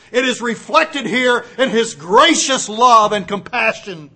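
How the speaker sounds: loud, high, impassioned preaching; fiery preaching. Real, not acted. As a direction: thunder out God's love to the congregation. Preacher rising to climax.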